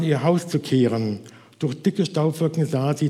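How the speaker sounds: usual speaking voice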